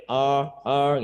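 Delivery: "R, R" with the tongue not touching, no trill; English r. Shown as a demonstration. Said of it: "kayak orang mungkin cadel". (R Inggris tanpa getaran)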